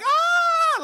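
high-pitched mock kid cry; very high little "ah" of a toddler; imitated child's whine. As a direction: imitate a small child's helpless squeal. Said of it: "he's normally like".

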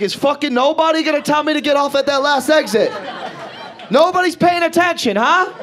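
high, loud furious dad rant in the car; mock yelling dad at the wheel. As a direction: blast it like an Italian father who missed the exit. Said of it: "He was like".

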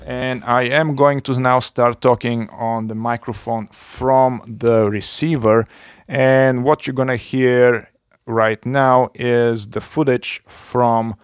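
usual speaking voice

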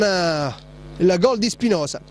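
ordinary talk

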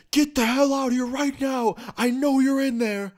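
high, loud furious yell at the door; loud raging shout, slowing at the end; retold scream. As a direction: scream it like an angry stranger outside. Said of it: "And then he starts screaming".

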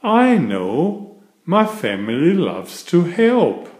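cheerful bedtime story reading; reading to children. A narrator's reading. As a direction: read it like a picture book to little kids. Reads picture book to children.

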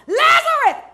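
high, loud shout of the name; single out one man with a shout; booming high summons; strong commanding call. Acted. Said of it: "Strong word".

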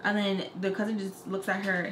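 ordinary talk

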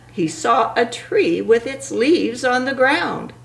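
usual speaking voice

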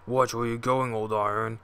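grumpy steamroller's mild growl; a touch of bad-tempered growl. A narrator's reading. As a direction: growl a warning at the engine. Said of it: "growled George".

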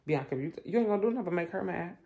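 ordinary talk, nothing marked

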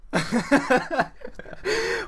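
a big, high, loud burst of laughter; delighted laugh. Real, not acted. Vlogger laughing after playing his edited voice clip, high and loud.

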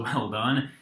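ordinary talk

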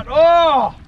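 excited. Real, not acted. Fish strikes again.

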